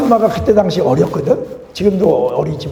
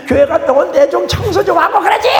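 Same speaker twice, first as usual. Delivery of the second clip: nagging spouse; 12 semitones higher, 4 dB louder than usual. Retold or imitated. Spouse nagging after church.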